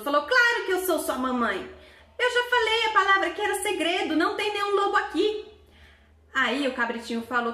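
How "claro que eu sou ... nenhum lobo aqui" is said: the wolf caught out, still insisting he is mother; nervous wolf pleading. Acted. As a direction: protest too much, caught out.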